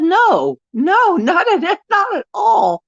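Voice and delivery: high, laughing no, not at all; laughing denial. Real, not acted. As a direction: laugh it off while saying no.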